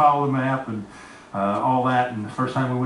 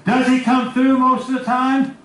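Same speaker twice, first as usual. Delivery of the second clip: preaching question; 9 semitones higher, 5 dB louder than usual. Acted.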